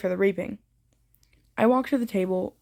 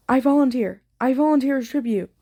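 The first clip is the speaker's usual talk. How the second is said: higher, louder urgent cry; a pitched-up shout, not full-out screaming; 5 semitones higher, 5 dB louder than usual. A narrator's reading. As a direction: call it out as a raised-voice shout. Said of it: "I shout".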